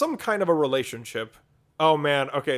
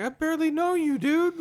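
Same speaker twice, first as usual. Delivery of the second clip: high mock voice; 13 semitones higher than usual. Retold dismissive reply to partner.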